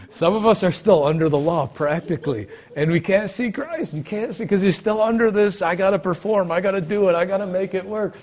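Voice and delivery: loud, high-pitched preacher's urgency; fired-up preaching. Real, not acted. Preaches at full force.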